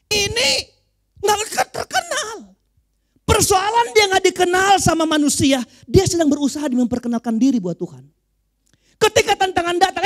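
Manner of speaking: high, loud pulpit proclamation; full-force preaching. Real, not acted. Preacher on the beggar unknown to men, full-force preaching. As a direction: raise the voice and drive it at the congregation.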